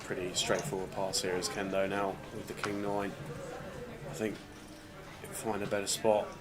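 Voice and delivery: hushed poker commentary. Acted. Quiet play-by-play commentary.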